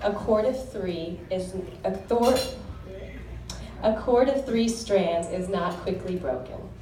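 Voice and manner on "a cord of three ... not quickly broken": normal talking voice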